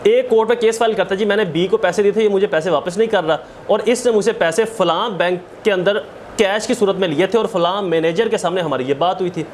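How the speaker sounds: normal talking voice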